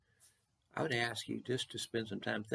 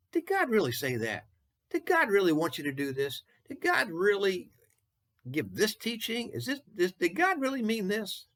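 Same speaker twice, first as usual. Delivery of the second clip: higher, louder, insistent sly questioning; Satan's doubting voice; 8 semitones higher, 4 dB louder than usual. Retold or imitated. Preacher voicing Satan tempting people to doubt God, higher and insistent. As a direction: needle them with doubt like the tempter. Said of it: "That's how Satan works".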